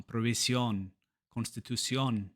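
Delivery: provisión, constitución stressed on the last syllable; Spanish provisión, constitución with a full, stressed final O. Shown as a demonstration. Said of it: "it's always that O".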